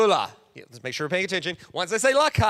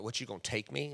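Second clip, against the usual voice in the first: mock offended, quieter; indignant modern woman; 8 semitones lower, 12 dB quieter than usual. Imitating a modern woman brushing off a proposal. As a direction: scoff at the old idea. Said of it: "Today's woman would be like".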